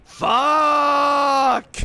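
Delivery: one long, high, loud scream; top-of-lungs yell. Retold or imitated. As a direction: bellow it out and hold the vowel. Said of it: "yell at the top of his lungs".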